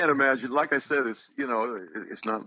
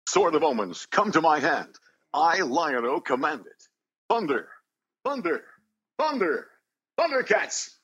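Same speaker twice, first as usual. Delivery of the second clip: heroic cry building higher and higher; Lion-O battle cry; 8 semitones higher, slower than usual. Shown as a demonstration. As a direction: summon the sword like Lion-O, rising to a shout. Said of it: "the battle cry just one time".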